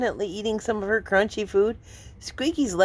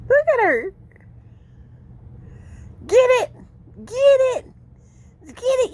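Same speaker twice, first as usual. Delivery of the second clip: high, coaxing pet voice, louder; 13 semitones higher, 3 dB louder than usual. Real, not acted. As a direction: egg the kitten on playfully. Egging on the kitten.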